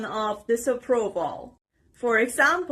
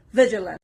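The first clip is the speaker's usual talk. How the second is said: "vigilant" stressed on VIG, the rest light; stress on first syllable; 2 semitones lower, 4 dB louder, much faster than usual. Shown as a demonstration. Teacher modelling a new word, first syllable stressed. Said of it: "The stress is on the first part".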